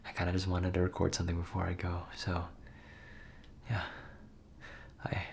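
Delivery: quiet at night whisper. Real, not acted. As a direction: whisper so the roommates sleep. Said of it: "Sorry, I'm whispering".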